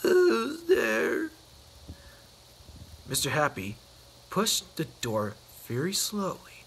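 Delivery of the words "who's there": very high squeak, asking again; squeaky sad voice. Acted. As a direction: pitch it right up, small and forlorn. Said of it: "a sad, squeaky sort of voice".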